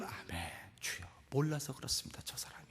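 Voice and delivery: very soft, low murmured prayer; hushed, submissive prayer under the breath; patient endurance. Acted. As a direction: murmur it meekly, holding back. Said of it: "이렇게 참는 겁니다".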